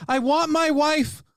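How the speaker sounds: mock-pitiful whimper for his wife; whiny pleading. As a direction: whine like a stranded tired husband. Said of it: "just going".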